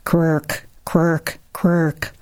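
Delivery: lightly voiced mother hen's hushing cluck; a hint of a reassuring broody hen; deep-toned hen. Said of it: "She says in a deep tone".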